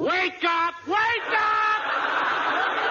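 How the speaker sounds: high-pitched comic yelp, drawn out; high, stretched alarm call; urgent wake-up cry. Acted. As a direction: cry out to break up her dream. Interrupts her dream of mink coat.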